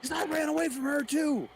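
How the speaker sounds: high, loud mock Goggins; Goggins impression. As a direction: shout it proudly.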